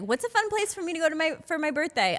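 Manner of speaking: high, quick eager question; imitated girl messaging. Girls messaging her for birthday ideas, high imitated plea. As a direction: put on a high, hurried girlish ask. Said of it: "Girls will always D M me like".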